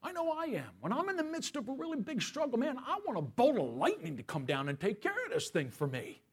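normal talking voice

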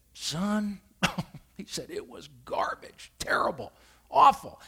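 whispered confidential disgust; hushed verdict. Retold or imitated. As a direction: lean in and whisper the verdict like a secret. Pastor's disgusted verdict on gumbo.

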